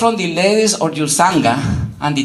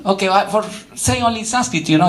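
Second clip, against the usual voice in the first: ordinary talk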